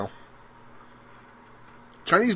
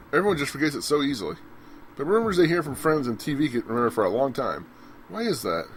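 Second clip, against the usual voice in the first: normal talking voice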